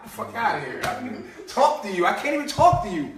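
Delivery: usual speaking voice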